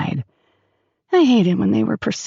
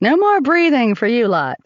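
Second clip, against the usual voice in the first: higher, playful announcement; announcing; 5 semitones higher, 4 dB louder than usual. A narrator's reading. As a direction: announce it to the whole ward. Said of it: "Shar announced".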